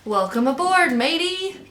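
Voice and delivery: high, hearty captain's hail; shouted welcome. A narrator's reading. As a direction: hail your friend aboard, voice rising. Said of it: "Captain Dalbert shouted".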